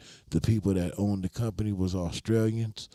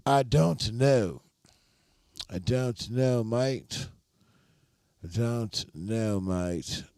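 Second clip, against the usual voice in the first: put-on Australian accent; 3 semitones higher, much slower than usual. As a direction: put on a playful Australian twang. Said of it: "a good accent, [name]".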